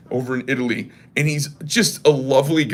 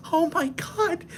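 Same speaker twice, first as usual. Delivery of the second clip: high breathy falsetto, gushing over the huge knife; hammed-up office gossip voice; gossiping coworker; 12 semitones higher than usual. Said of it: "She's like".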